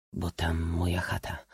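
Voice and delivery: soft, low Cossack reply; subdued. A narrator's reading. Cossack telling his lord about his own hut, subdued. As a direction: answer humbly, low and hushed. Cossack's dull answer to prince.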